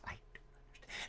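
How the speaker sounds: breathy near-whisper of disbelief. Real, not acted. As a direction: mutter it under your breath, baffled. Whispered complaint.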